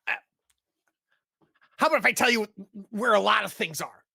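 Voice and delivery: high, loud, stammering plea through the pain; bleeding captive bargains with the cloaked orc, panicked and begging; panicked wounded man. Acted. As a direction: beg for your life, tripping over every word. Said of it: "And, and he goes".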